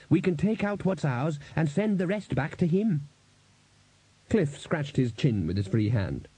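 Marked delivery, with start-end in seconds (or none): 0.1-3.0 higher-pitched, pressing proposal; urgent. A narrator's reading. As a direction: urge a way out. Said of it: "said Buddy urgently".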